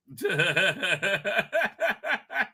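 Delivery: long bubbling giggle; real laugh. Real, not acted. Host amused by his own Agent Triple X joke, real laughing. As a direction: let a helpless chuckle run on.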